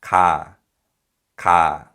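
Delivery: "Ka" said clearly and slowly, even and full; model syllable ka. Shown as a demonstration. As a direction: spell out the syllable "Ka" like a teacher. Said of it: "Here's an example".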